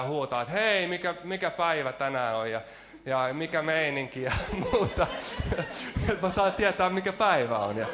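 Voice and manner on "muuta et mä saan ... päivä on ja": laughing while telling. Real, not acted. Laughs at his own habit.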